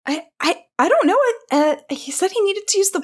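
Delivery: high, stammering, nervous servant; intimidated servant squeak; flustered servant. Acted. Voices startled servant being shouted at.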